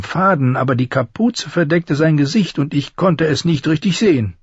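very high craftsman voice; high-voiced smith giving a description; master armourer, describing a stranger. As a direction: recount each detail in a high, eager-to-convince voice.

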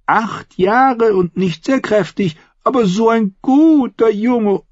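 high, loud, ranting Lysa; bitter aunt. A narrator's reading. Aunt Lysa rants to Sansa about Tyrion, high and heated. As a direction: rail against the dwarf, spiteful.

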